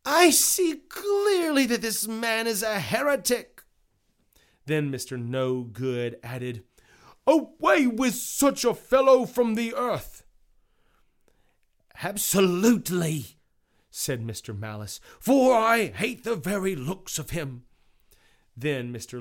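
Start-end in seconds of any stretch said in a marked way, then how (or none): 0.1-3.6 high-pitched indignant character voice; exclaiming juror. Acted. 7.3-10.2 high, loud outraged character voice; condemning juror. Acted. Juror condemning prisoner in acted trial. 12.0-13.4 malicious juror. Acted. Malicious juror agrees in acted trial. 15.2-17.6 higher-pitched hateful character voice; malicious juror. Acted.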